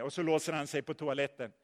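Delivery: ordinary talk, nothing marked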